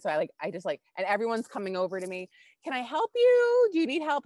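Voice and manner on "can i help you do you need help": high, loud sing-song offer of help; mimicked helpful gym-goers. Gym people coming over to her, mimicked helpful voice. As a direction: draw it out high and over-eager.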